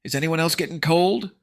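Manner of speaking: high, loud alarm; screeched question. A narrator's reading. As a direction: cry the question out. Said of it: "Vampy screeched".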